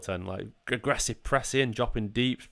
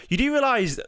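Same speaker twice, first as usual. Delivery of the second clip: exasperated; 12 semitones higher than usual. Real, not acted. Arguing with viewer in chat.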